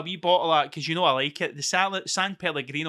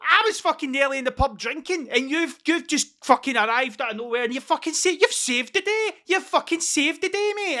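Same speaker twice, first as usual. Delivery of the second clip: high, loud gushing mate; overjoyed thanks to a friend; excited gushing thanks; 9 semitones higher, 4 dB louder than usual. Retold or imitated. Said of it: "And I was like".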